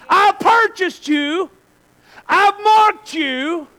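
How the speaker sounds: God's voice declaring his claim, slow at the end; preacher voicing God reassuring believers; lightly voiced God. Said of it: "He goes".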